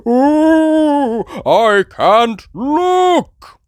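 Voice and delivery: high, loud, very slow wail; Daddy Pig scared. Acted. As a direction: groan in fear of heights. Daddy Pig afraid of height.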